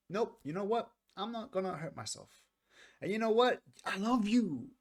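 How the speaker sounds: high, strained voicing of the singer's resolve. Reacting to the song, he voices the singer's resolve in a high strained tone. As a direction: pitch it up high as if the hurt singer finally speaks. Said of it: "and now it's like".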